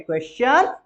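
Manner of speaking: normal talking voice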